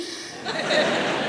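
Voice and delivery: real laugh, high and airy; high breathy laugh. Real, not acted. Speaker laughing at own anecdote before the audience, high airy laugh. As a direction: burst out laughing at the memory.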